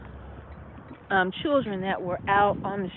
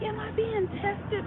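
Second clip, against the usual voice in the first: inner question; 13 semitones higher, 4 dB quieter, slower than usual. Retold or imitated. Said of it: "But I'm like".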